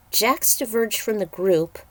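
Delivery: plain reading voice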